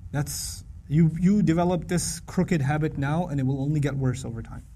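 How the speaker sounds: ordinary talk, nothing marked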